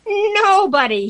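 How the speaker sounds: high, loud, drawn-out swoop; gleeful exclamation. A narrator's reading. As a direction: call it out as if sliding downhill. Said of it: "he said as he slid down the banisters".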